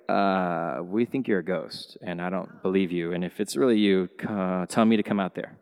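low, soft, doubting mutter; grudging disbelief under the breath; skeptical Peter. Acted. Peter's doubtful challenge acted.

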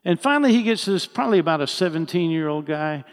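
normal talking voice

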